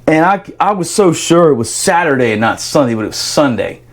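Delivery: ordinary talk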